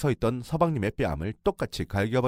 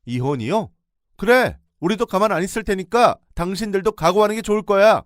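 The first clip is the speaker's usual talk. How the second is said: defiant shout; 10 semitones higher, 9 dB louder than usual. Retold or imitated. Defiant counter-threat.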